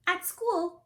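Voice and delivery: ordinary delivery, nothing marked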